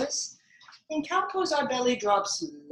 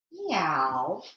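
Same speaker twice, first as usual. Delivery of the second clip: drawn-out swooping meow; cat meow; 4 semitones lower than usual. Pretend. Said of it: "Maybe take a balancing cat".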